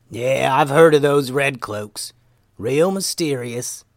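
slow, drawn-out character voice; slow Momo voice. Acted. Momo recalls the wizard tribe, slow and pondering. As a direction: muse slowly in a put-on voice.

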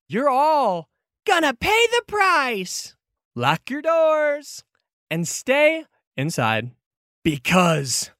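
slow, high, ominous bird proclamation; squawking Kenku warning. Acted. Rooftop Kenku warns the whole square, slow squawking menace. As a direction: draw out each threat from on high. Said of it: "squawks again".

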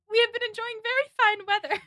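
high, put-on young girl's politeness; Margaret. Margaret filling the awkward silence, high polite small talk. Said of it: "And Margaret says".

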